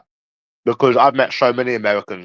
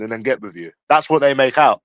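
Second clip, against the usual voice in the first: ordinary talk, nothing marked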